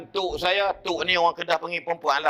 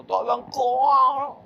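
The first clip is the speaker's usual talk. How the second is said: much higher, very slow strained plea; dying man's plea; 10 semitones higher, 3 dB louder, much slower than usual. Retold or imitated. Dying uncle pleading to the preacher, slow high strained voice. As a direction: stretch it out like a man near death. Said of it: "dia kata".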